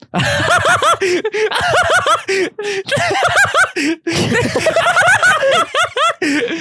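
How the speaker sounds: high shrieking laugh; helpless fit of laughter; hysterical laughter. Real, not acted. Bursts out laughing.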